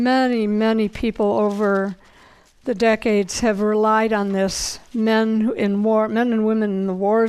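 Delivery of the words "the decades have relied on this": ordinary delivery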